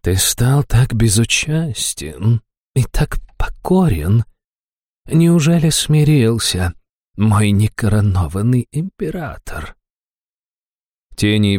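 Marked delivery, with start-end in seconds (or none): 0.1-9.7 slow, teasing seductive demoness. A narrator's reading. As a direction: taunt him slowly, sweetly. Succubus taunting the demon.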